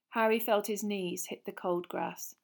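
ordinary delivery

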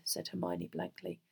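ordinary delivery

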